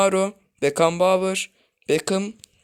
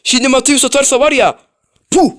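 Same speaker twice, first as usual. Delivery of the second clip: high, loud, hyped-up call; excited shout; 8 semitones higher, 13 dB louder than usual. Real, not acted. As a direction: yell it out as the shot comes. Excited anticipation of shot.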